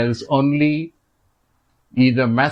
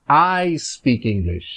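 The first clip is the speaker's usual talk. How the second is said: "I" drawn out and raised; stress on I; 3 semitones higher, slower than usual. Shown as a demonstration. Teacher showing emphasis on the subject, stressed "I". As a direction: lean slowly on the word "I". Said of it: "emphasize on the subject".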